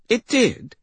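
very high, loud startled question; astonished girl. Acted. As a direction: ask in squeaky disbelief.

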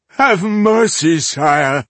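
high, loud, drawn-out begging; whining plea. A narrator's reading. Captured rebel begging the elf for mercy, whining. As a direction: whine for mercy. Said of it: "Zedric was the first to whine".

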